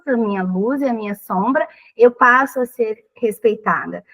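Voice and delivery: normal talking voice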